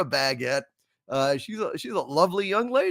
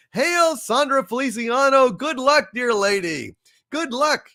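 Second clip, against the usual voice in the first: high, loud calling-out send-off; cheering farewell; 8 semitones higher, 4 dB louder than usual. Real, not acted. Host cheering off Sandra on her secret mission, loud and high. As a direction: hail her like a herald.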